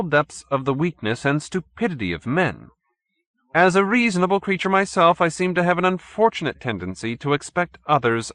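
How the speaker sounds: lightly voiced arrogant villain; haughty chairman. A narrator's reading. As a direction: sound superior and patient. Stolz muses aloud.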